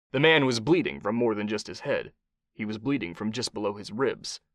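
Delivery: ordinary delivery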